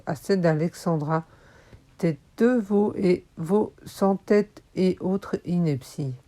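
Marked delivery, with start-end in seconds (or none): none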